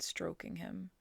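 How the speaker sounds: usual speaking voice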